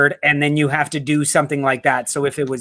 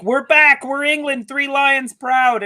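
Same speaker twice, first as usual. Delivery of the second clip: high-pitched crowing fans; mock triumphant England supporters, pitched up; mock fan boasting; 9 semitones higher, slower than usual. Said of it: "they're like".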